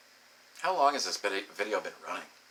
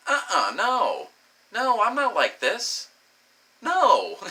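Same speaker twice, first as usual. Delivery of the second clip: high, drawn-out mock protest; upset protest; 7 semitones higher, 3 dB louder, much slower than usual. Upset woman insisting to her lover she's not like this, retold high and drawn-out. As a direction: imitate her upset, stretching each refusal high. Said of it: "she's legitimately upset".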